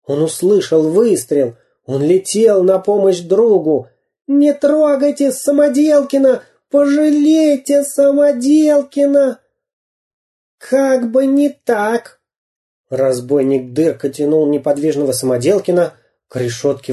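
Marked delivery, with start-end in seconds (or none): 4.3-9.4 high-pitched pleading cry; desperate plea. A narrator's reading. Карандаш begs robbers to spare his friend, pleading. 10.6-12.2 high, slow taunt; mocking refusal. A narrator's reading. Robber rejects the plea to spare Самоделкин, mocking.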